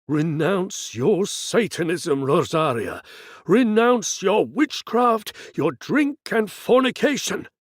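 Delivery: slow, high, loud priestly command; commanding exorcist. Acted. Priest ordering the woman to renounce sin, booming command. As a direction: thunder out the exorcism over her.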